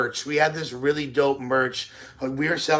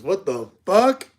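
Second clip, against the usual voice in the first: slow, drawn-out disbelief; shocked reaction; 5 semitones higher, 4 dB louder, slower than usual. Real, not acted. Streamer reacting to a small tip, slow and stunned. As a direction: let the shock stretch the words out.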